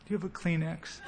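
softly played little sniffle; mock dainty weeping. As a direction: make light, polite mock tears. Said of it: "It means not".

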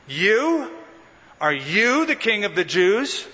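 "You?" split off as a scoffing question, then "you" punched in "Are you the king"; stress on YOU. Shown as a demonstration. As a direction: lean hard on "You?" with disbelief, then press "you" again. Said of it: "You see the emphasis?".

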